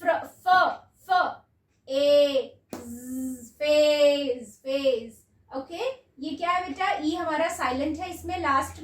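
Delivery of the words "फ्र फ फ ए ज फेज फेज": फ, ए, ज held apart, then joined slowly into फेज; sounding out. Shown as a demonstration. Teacher to children blending फेज, sound by sound. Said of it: "उसके बाद".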